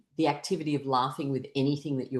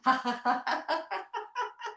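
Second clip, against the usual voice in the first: high, rapid forced giggle; laughing sound; 14 semitones higher, 3 dB quieter than usual. Shown as a demonstration. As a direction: laugh like an engine starting. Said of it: "with a laughing sound".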